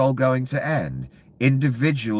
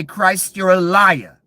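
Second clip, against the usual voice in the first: higher and louder, throwing it back; defiant outburst, higher and louder; defiant shout; 7 semitones higher, 6 dB louder, slower than usual. Real, not acted. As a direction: shout down even Christ. Imagined retort to Christ.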